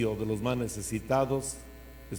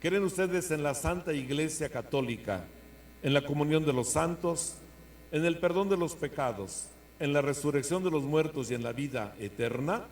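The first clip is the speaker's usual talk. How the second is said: liturgical proclamation; 2 semitones higher, 3 dB louder than usual. Acted. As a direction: proclaim each question solemnly. Priest leads creed questions.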